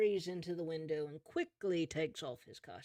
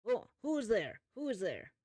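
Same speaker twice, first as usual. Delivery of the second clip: higher-pitched questioning character voice; lightly acted startled priest; father calling out; 6 semitones higher than usual. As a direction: call out warily to an unseen visitor. Game master voices the startled father.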